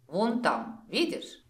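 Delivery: plain reading voice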